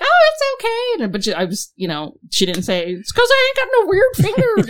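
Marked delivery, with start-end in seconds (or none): 0.0-1.0 very high squeaky imitation; high-pitched voice. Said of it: "She's got a high-pitched voice". 2.9-4.7 very high, louder mock receptionist; high-pitched voice. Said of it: "She's got a high-pitched voice".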